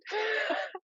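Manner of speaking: high giggle at her own impression; real laugh. Real, not acted. Speaker amused by the video she mimicked, giggling. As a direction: crack up, softly and high.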